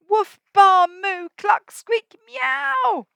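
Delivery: loud, high jumble of animal calls; bark, bleat, moo, cluck, squeak and mew; animal chorus. Acted. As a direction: animals chasing the cat. Animals all chasing the cat.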